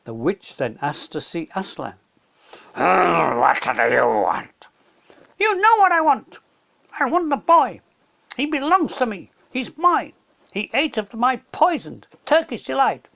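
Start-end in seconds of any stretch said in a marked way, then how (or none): 2.8-4.7 calm lion king. Acted. Aslan faces the witch, slow and kingly. 5.4-13.1 much higher, louder greedy claim; demanding witch. Acted. Witch demands Edmund from Aslan, shrill and loud.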